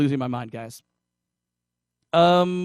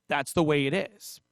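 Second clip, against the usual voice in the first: normal talking voice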